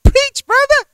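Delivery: a tiny squeaky congregant calling out. Pretend. A churchgoer cheering the preacher on, very high and squeaky. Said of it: "I kinda sounded like a midget there".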